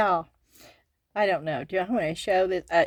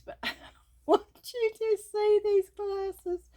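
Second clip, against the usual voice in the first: higher, slow words squeezed out through laughter; laughing through words; 14 semitones higher than usual. Real, not acted. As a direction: try to talk while still laughing.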